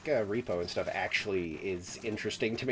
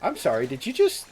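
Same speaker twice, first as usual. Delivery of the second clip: loud, incredulous questioning, rising at the end; mock disbelief; 9 semitones higher, 7 dB louder than usual. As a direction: act out a baffled listener, higher on the repeat. Acts out a baffled listener.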